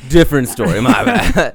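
high laughing apology. Real, not acted. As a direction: giggle out the apology.

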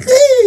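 mock shriek, way up high; high squeal of fright. Imitating a relative's squeal at the sight of a needle. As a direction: squeal like a grown man scared of needles. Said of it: "That's what he sounds like".

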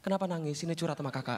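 low, softened big-brother voice; mock consoling. Big brother invites a weeping ghost to confide, mock consoling. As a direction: drop low and soft to coax it.

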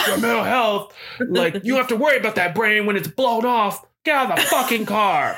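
incredulous crime boss, hammed up; scornful boss. Boss scoffs at a recruit's mental health worries, exasperated.

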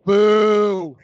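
loud playful booing. Real, not acted. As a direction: jeer it out loud. Mocking booing a teammate's nickname.

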